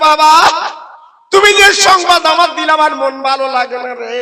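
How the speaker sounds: loud, high chanted outcry; crying out. Acted. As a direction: wail it out melodically, stretching the ending. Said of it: "শোনার পর আমিনা চিল্লে বলছে".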